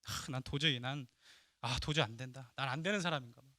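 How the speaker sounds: low, soft, defeated murmur; despairing inner voice. Retold or imitated. Peter telling himself he is hopeless, softly and low. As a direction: voice a man who has given up on himself.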